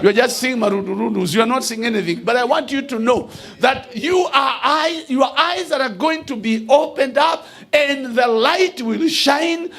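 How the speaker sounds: shouted sermon declaration; full-force preaching. Acted. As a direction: thunder the promise over the church.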